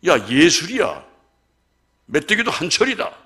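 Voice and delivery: loud teasing quote of the wife; mock wife's praise. Wife teasing her actor husband, loud mock cheer. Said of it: "이런 소리를 해가면서".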